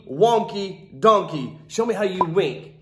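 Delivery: ordinary delivery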